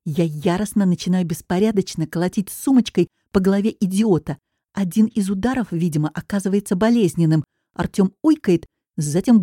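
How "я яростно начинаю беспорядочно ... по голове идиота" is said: usual speaking voice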